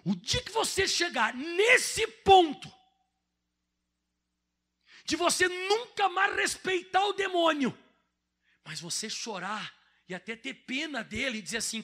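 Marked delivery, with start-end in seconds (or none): 0.0-7.8 high, loud and slow, every word drawn out; slow preaching shout. Real, not acted. Preacher rousing the church, a slow ringing shout. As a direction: preach it out at full force.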